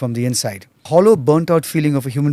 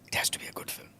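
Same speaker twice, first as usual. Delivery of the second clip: whispering; 3 semitones higher, 3 dB quieter than usual. Real, not acted. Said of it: "whispered emphasis".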